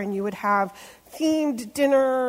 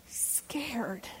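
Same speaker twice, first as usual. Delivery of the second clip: whispered word, much softer; whispered scared; 13 dB quieter than usual. Acted. Preacher telling of Peter's fear, whispered.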